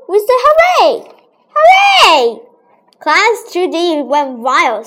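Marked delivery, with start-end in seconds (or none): 0.1-2.4 high, loud hooray cheer. Retold or imitated. Class cheering the planet play, high and loud.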